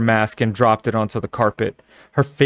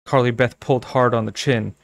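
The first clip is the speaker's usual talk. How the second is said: ordinary delivery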